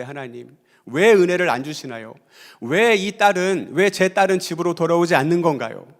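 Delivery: retelling a mother's bitter cry to God; resentful prayer. Retold or imitated. Mother complaining to God about her missing daughter, loud and resentful. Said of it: "원망이 막 올라오기 시작하는 거예요".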